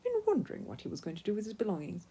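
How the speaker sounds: plain reading voice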